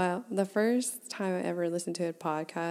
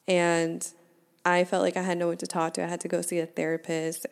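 ordinary delivery

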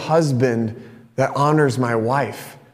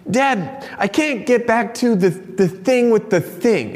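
higher-pitched imitation of an upset child; upset young daughter; 8 semitones higher, slower than usual. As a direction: mimic a little girl's frustrated complaint, without real crying. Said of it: "she's crying, she's angry".

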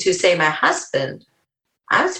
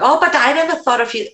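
mock naive quote; 9 semitones higher, faster than usual. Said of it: "what are you talking?".

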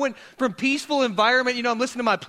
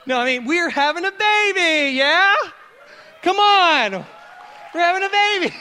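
much higher, loud joyful shout; excited announcement; 10 semitones higher, 7 dB louder than usual. Real, not acted. Pastor announcing the baby to his congregation, excited shout. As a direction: announce it like big news. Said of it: "Room just got real quiet on that one".

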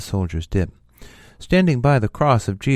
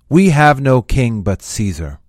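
forceful, much louder declaration; loud retort; 3 semitones higher, 9 dB louder than usual. A narrator's reading. Chief priests answer Pilate, loud retort. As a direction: throw the answer back firmly.

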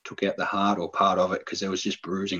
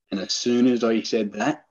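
ordinary talk

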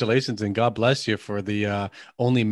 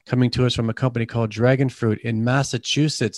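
ordinary delivery

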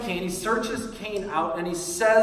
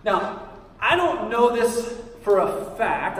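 normal talking voice

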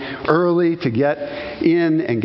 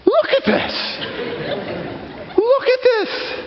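high, amazed cry of wonder; awestruck exclaiming. Retold or imitated. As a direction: gasp it out in awe at the view. Awed exclamation at mountain view.

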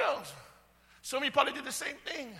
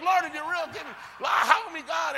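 loud, raised cry for help; desperate retold prayer; 5 semitones higher, 7 dB louder than usual. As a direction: act out pleading with God at high volume. Reenacts desperate prayer from the bin.

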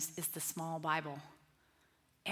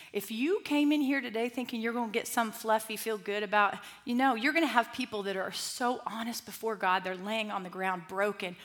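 ordinary talk, nothing marked

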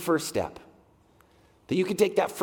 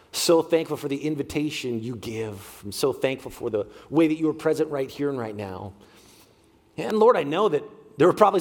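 prayer; 4 semitones lower than usual. Acted. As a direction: pray with humble sincerity. Pastor leads prayer.

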